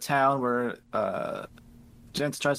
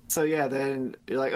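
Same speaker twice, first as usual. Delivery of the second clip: ordinary delivery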